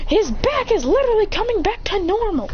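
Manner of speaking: high-pitched astonished outcry; hammed-up high disbelief; mock alarm. Kakashi reacting to his teammate's back, alarmed outcry.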